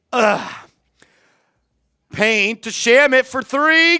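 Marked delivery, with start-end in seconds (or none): 2.1-4.0 energetic sportscaster call; play-by-play call. Acted. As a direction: call the game live like a broadcaster. Live basketball play-by-play.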